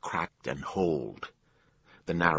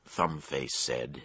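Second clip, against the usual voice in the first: ordinary delivery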